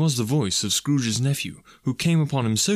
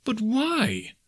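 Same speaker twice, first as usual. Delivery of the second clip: high-pitched, dismayed question; baffled cry; 12 semitones higher than usual. A narrator's reading. Nephew refused by his uncle, baffled cry. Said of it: "cried Scrooge's nephew".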